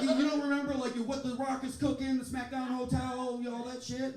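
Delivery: high, loud incredulous rant; exasperated disbelief. Retold or imitated. Comic quizzing party guests who forgot The Rock, exasperated. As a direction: pitch it up and belt the catchphrases.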